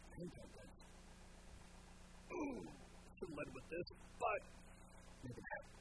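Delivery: soft, almost whispered; hushed Jesus. Retold or imitated. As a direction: confide it half under the breath. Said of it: "And Jesus goes".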